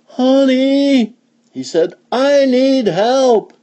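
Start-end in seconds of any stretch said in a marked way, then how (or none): 0.0-1.2 high, drawn-out call to a wife. Acted. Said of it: "the farmer called his wife". 2.1-3.5 high, slow holler; farmer's drawn-out plea; calling for help. Acted. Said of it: "the farmer called his wife".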